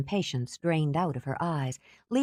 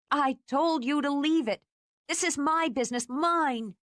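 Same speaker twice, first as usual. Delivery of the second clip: furious; 10 semitones higher than usual. Acted. Furious, high.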